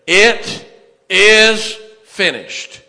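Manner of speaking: loud, high, drawn-out proclamation; high priest's proclamation. Acted. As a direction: holler it out slowly to the whole congregation. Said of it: "raise his hands up and say".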